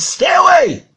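loud, high-pitched command to back off; acted forceful father's yell; shouted warning. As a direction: ward off an intruder with a shout. Father warns off the stranger.

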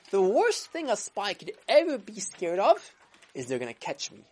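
ordinary delivery

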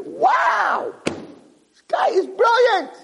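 very high, slow shout of amazement; amazed shout. Acted. The owner marvelling aloud at his clever guard, high shout. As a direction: cry out in delight at his genius.

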